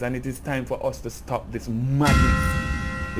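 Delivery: usual speaking voice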